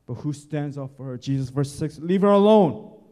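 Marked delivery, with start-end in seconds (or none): 2.0-3.0 commanding. Acted. Jesus defends the woman.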